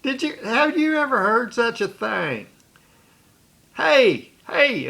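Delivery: high, mocking teasing voice with a laugh in it; jeering prankster egging the crowd on; mocking buddy. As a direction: crow about it to everyone, high-pitched and gleeful. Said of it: "He said".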